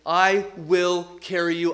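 "I will carry you" slowly, each word weighted; emphatic promise. Retold or imitated. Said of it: "Emphatically saying".

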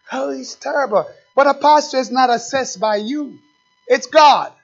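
usual speaking voice

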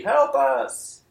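high desperate cry for help; shouted plea. Retold or imitated. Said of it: "it's almost like he's shouting a plea".

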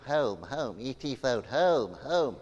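lightly voiced croaky alien; a hint of E T pleading to go home. Preacher quoting E T to the congregation, lightly voiced alien. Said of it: "Remember that?".

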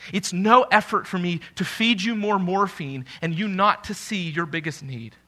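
usual speaking voice

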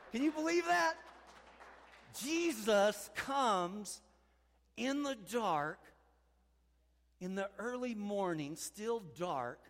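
high, loud and very slow; slow proclaiming. Acted. Preacher revealing how Jesus rises, slow and loud. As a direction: stretch each word with wonder.

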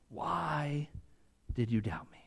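a hushed whisper of the question; whispered memory. Acted. Peter replaying Jesus' question in his head, whispered.

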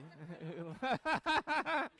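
real burst of laughter. Real, not acted. Preacher cracks up at an interruption, laughing. Laughs after own joke.

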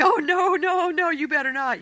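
hammed-up jealous sisters squealing in protest; panicked bad sisters. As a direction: beg him frantically not to do it. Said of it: "the princess bad sisters are going".

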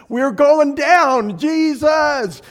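lightly hammed-up distress call; mock alarm. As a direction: act out a plane passenger calling out. Re-enacting wife's cry in crisis.

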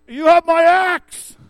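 high, slow, booming yell. Retold or imitated. Gimli pledging his axe to Frodo, a slow booming yell. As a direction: bellow it out like a proud dwarf. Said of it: "And he yells out".

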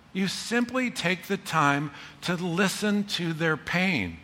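ordinary talk

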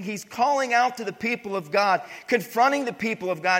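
loud, ringing pulpit voice; fiery preaching. Acted. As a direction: preach it out to the whole room. Preaching at full force.

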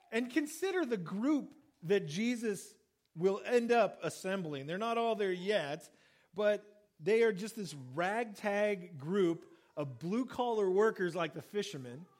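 usual speaking voice

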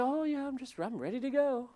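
high, breezy mock of careless believers; flippant mock. Pastor mocking flippant Christians, high breezy voice. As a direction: toss it off like it's nothing. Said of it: "And we say".

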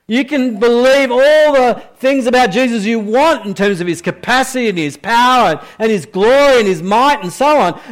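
impassioned preaching. Acted. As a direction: build the appeal with fervour. Preaching climax, rising intensity.

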